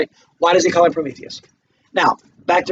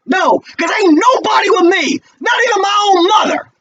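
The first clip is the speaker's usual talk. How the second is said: much higher, shouting and raw; anguished shout; 14 semitones higher, 3 dB louder than usual. Acted. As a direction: cry out that nobody stands with you. Walter's anguished reply.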